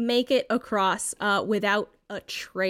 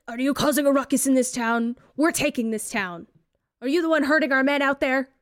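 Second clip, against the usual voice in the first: higher and louder hostile challenge; threatening thug; 5 semitones higher, 5 dB louder, faster than usual. Acted. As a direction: bark at the stranger, knife at a throat. GM voices threatening knife guy.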